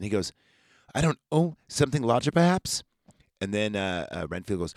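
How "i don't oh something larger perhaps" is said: higher, lightly mocking questions; a hint of a teasing doctor, pitched up; probing doctor. Doctor prods Renfield.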